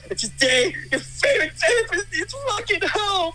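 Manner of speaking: breaking down. Acted. Therapist begging his client on the phone, sobbing. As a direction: voice cracking high into a sob.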